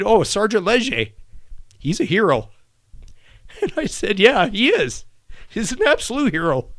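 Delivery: real tearful high voice, pushing words out; fighting back tears, pitch climbing; choked up. Real, not acted. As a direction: voice breaking upward with grief and pride. Choking up recalling praise of dead friend.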